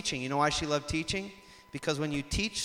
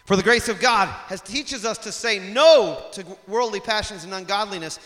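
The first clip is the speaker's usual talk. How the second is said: high, loud, ringing scripture read; full-force preaching; 6 semitones higher, 8 dB louder than usual. Acted. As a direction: proclaim it to the whole congregation.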